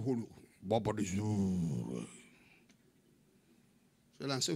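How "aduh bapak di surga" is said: low, slow, drawn-out pious prayer; mock pulpit voice. Mocking preachers at the pulpit, ponderous prayer voice. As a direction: intone it with exaggerated solemnity. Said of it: "Tapi begitu di mimbar".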